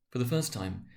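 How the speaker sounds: usual speaking voice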